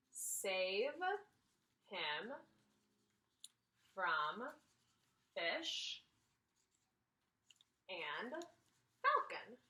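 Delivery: each word drawn out, slow; slow dictation. Shown as a demonstration. Teacher dictating slowly while writing on the Venn diagram. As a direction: spell it out slowly as you write. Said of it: "slow dictation while writing".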